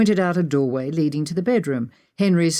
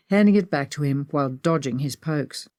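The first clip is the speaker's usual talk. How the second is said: plain reading voice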